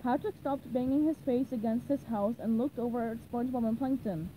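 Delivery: plain reading voice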